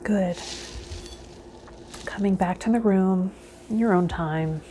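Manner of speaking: usual speaking voice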